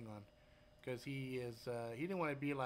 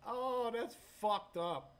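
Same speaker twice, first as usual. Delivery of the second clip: higher, louder, drawn-out disgust; slow disgusted groan; 8 semitones higher, 6 dB louder, slower than usual. Real, not acted. Reacting to the rotting smell.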